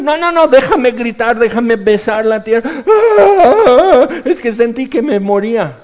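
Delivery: high, loud frantic begging; desperate pleading. Acted. Castaway on the beach begging to kiss the ground, loud and frantic. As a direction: beg to be let scream.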